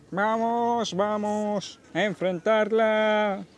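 slow, grand movie-trailer voice; epic announcer voice. Acted. Dramatic slow hype speech.

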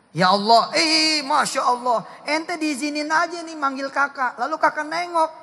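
high, loud, fervent sermon; ecstatic preaching. Real, not acted. Preacher describing God answering, high and loud. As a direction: let the joy of it ring out.

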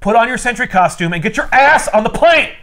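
mock yell. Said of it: "he yells the schizophrenia out".

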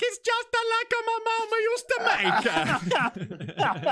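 hammed-up Italian accent, very high and loud; slow, exaggerated sing-out Italian accent. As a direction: belt out a cartoon Italian pizza man. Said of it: "turn up and be like".

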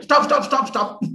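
mock urgent call to halt the car; imitating a president's sudden cry to stop; retold urgent shout. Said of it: "Kalam shouted".

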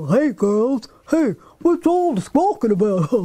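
hearty farmer with belly chuckles; jolly farmer. Acted. Farmer Barney greeting the girls, jolly and hearty. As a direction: boom it out like a folksy old farmer.

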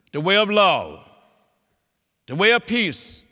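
fervent oratory; preaching. Real, not acted. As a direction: ring it out like a sermon. Preaching nonviolence.

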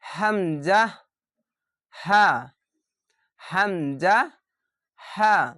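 হামজা said from the throat, then হ্যাঁ as a short sharp glottal ha; hamza demo. Shown as a demonstration. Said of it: "এটি এরকমভাবে উচ্চারণ হবে".

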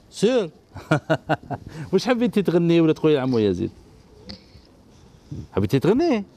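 ordinary talk, nothing marked

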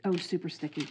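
ordinary talk, nothing marked

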